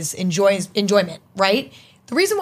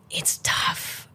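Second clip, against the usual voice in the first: breathy whisper on tough; 8 dB quieter than usual. Real, not acted. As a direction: drop to a whisper. Sighing over copycats.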